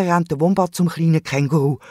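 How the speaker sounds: ordinary delivery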